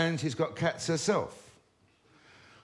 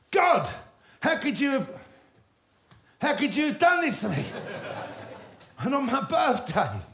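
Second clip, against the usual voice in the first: anguished cry; 10 semitones higher, 4 dB louder than usual. Acted. Grief over the dead cat.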